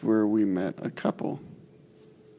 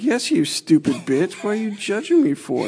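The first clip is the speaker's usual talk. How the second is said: higher, exasperated comeback; retelling an irritated reply to a drunk stranger, pitched up; exasperated retort; 10 semitones higher, 3 dB louder than usual. Retold or imitated. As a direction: snap back in disbelief, pitched up. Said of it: "I was like".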